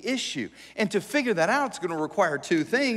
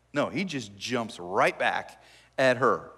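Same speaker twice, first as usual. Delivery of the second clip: ordinary talk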